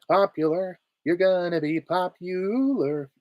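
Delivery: high, drawn-out singsong woman's voice; man playing a girlish witch; Glinda. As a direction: stretch it out sweetly, high-pitched. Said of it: "from Glinda from Wicked".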